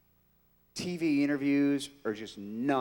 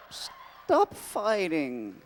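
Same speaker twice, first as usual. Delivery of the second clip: slow, drawn-out whiny plea; pleading Joxer; 3 semitones higher, much slower than usual. Acted. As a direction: plead like a hapless sidekick.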